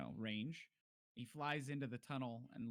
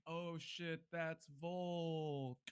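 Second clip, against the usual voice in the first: higher, lightly hammed-up dawning surprise; mock realization; 4 semitones higher, much slower than usual. Game master imagining the player mid-firebolt, mock dismayed recognition. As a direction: act out recognising someone mid-attack. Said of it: "happening as you're like".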